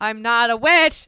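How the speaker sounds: loud, drawn-out mock denial; imagined defendant's protest. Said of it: "just her going".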